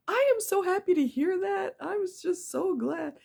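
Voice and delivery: high, bright excited voice; excited dentist. Retold or imitated. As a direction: gush it like a happy dentist. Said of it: "He's like".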